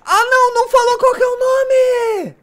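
high, loud frightened wail; real fright. Real, not acted. Gamer startled in the game, crying out in fear. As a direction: cry out in sudden fear. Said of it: "tô com medo".

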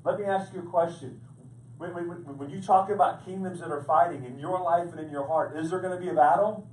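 preacher rising to a fervent pitch; full force preaching. Acted. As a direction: drive the question home to the congregation.

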